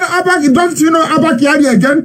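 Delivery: mimicking officials insisting on their man; mock pleading official. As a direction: plead as if the name should matter.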